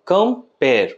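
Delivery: Compare broken into two beats, কম and pair; split into syllables. Shown as a demonstration. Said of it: "একবার হবে কম এবং দ্বিতীয়বার হবে pair".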